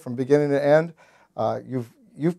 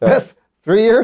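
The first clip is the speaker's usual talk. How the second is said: slow, higher and louder disbelieving echo; incredulous; 8 semitones higher, 6 dB louder, slower than usual. Real, not acted. Host echoing the guest's joke about college, incredulous. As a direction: drag it out in amused disbelief.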